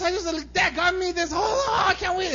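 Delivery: put-on high, shouted kid voice; loud hammed-up child excitement; excited child. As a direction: imitate a thrilled kid squealing to parents. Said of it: "He gets so excited".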